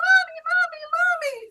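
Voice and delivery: put-on high, loud children's cry of Mommy; calling out. Little daughters running to their mother, shouting Mommy. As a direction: call out joyfully across the room.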